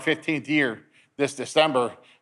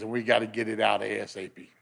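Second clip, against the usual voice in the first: low, subdued urgency; retold doctor, softer and lower; grave doctor; 6 semitones lower, 7 dB quieter than usual. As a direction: say it quietly, pitched down, as hard news. Doctor urging surgery, retold.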